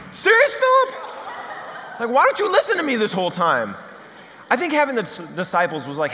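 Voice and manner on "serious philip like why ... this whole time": much higher, louder exasperated. Acted. Jesus fed up with Philip not listening, exasperated. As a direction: throw up your hands at him.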